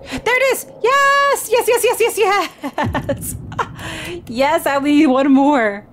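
very high joyful yes, laughing; excited cheering. Real, not acted. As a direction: cheer with delight. Found a cheese piece.

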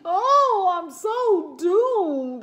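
loud, slow, high despairing wail; dramatic wail.

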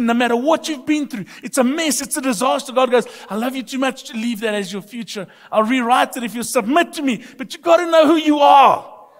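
high, loud, impassioned sermon delivery; full-force preaching. Real, not acted. Preacher tells congregation how much God loves them, impassioned and loud. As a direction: drive it home to the congregation, building to a shout.

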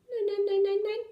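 very high, frightened little mouse; anxious squeak. A narrator's reading. Little mouse denying it to the dog, anxious high squeak. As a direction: squeak it out in a quick anxious string. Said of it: "piepste das Mäuschen ängstlich".

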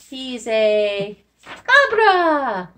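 very slow, stretched answer; drawn-out reveal. Shown as a demonstration. Teacher revealing the goat word, very slow and drawn out.

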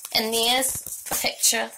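plain reading voice

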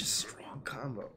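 soft, slow whispered aside. Real, not acted. Streamer whispering about a combo to chat, whisper. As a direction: hush it like a secret.

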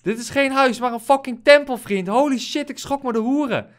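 high, loud thrilled gushing; excited. Real, not acted. Gamer still thrilled, telling his friend about the temple. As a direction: keep riding the high of the discovery.

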